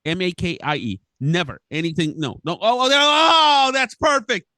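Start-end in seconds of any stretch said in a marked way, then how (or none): 2.6-4.5 much higher, louder, slowed sarcastic "that's perfect"; sarcastic dismay. Real, not acted. Water spills into his gear, high sarcastic dismay. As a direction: crack up in disbelieving sarcasm.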